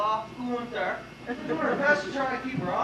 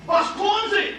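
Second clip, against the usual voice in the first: startled shout; 7 semitones higher, 4 dB louder than usual. Acted. Gunther startles the intruders.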